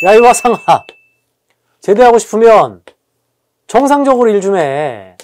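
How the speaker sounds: loud, punched-out mock scolding; angry senior soldier. Senior soldier telling a recruit to work properly, angry yell. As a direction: bawl out the new recruit in separate bursts. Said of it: "막 이제 화를 냅니다".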